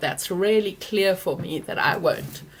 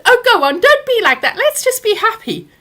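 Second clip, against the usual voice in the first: much higher, louder wheedling mum voice; mock dismissive mum; 9 dB louder than usual. Mother urging daughter to drop it at Easter, high mocking wheedle. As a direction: brush her off like it's nothing, high and loud. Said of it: "And she said".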